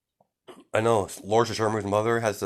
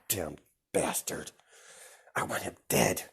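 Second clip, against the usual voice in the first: menacing villain mutter; 5 dB quieter than usual. Retold or imitated. Shigaraki muttering murderous threat.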